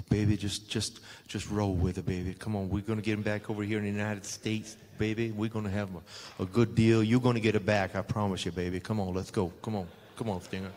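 lightly voiced Dusty coaxing him along; soothing low imitation of a wrestler calling him baby; Dusty impression.

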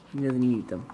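normal talking voice

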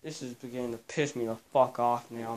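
normal talking voice

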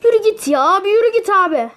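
loud, high shout; frustrated shout. Real, not acted. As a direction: yell at the screen. Yelling at enemy in game.

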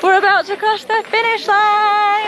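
high, excited finish; thrilled to be almost done. Real, not acted. Reaching the end, excited.

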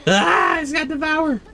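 high loud alarmed cry; startled scream. Real, not acted. Gamer warns team the killer got Devour, high alarmed cry. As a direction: scream then warn the team.

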